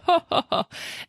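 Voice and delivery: high, light acted chuckle; dismissive laugh. A narrator's reading. Bartender brushing off a ghost question, dismissive laugh. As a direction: laugh it off in character. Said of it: "he laughed it off".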